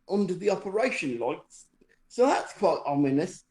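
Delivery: normal talking voice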